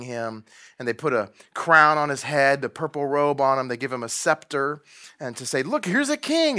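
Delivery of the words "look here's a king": high taunting jeer; mocking soldier.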